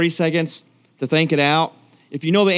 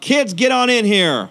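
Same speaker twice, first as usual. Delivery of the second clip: put-on slow, high call to the kids; yell to the kids; 8 semitones higher, slower than usual. Shown as a demonstration. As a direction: call them in, drawn out. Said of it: "you have to yell real loud".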